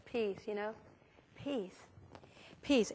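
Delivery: "Peace" said very softly, slow and drawn out; soft mantra. Shown as a demonstration. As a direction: breathe the word "Peace" like a quiet prayer. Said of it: "you say it like this".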